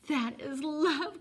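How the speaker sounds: slow, loud, high exclamation; rapturous cry. A narrator's reading. Alan to the old man, swept away, crying out. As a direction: proclaim it as a revelation. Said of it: "cried Alan".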